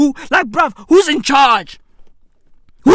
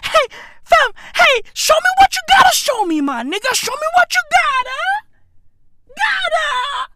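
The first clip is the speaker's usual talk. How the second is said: much higher, fast excited begging; eager pleading; 14 semitones higher than usual. Retold or imitated. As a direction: beg him to show it, squeaking with excitement. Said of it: "And I was like".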